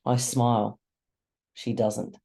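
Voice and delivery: plain reading voice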